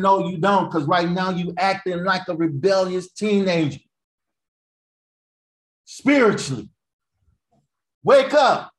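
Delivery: high, loud preacher's rebuke; preaching. Real, not acted. Preacher telling the congregation to wake up, forceful rebuke. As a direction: call them out, punching it high and loud.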